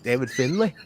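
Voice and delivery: light New Zealand accent, imitating; a quick put-on Kiwi "g'day"; mock Kiwi accent. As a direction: toss off a mock Kiwi greeting to a rival. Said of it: "His accent is too nice to sound like a tough guy".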